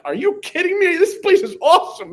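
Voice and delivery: high, loud, thrilled exclamation; amazed excitement. Retold or imitated. Freshman at his first pep rally, gushing excitedly. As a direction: gush like an awestruck freshman.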